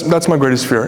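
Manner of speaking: usual speaking voice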